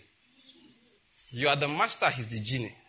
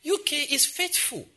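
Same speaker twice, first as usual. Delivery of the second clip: mocking retort; 8 semitones higher, slower than usual. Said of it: "I say".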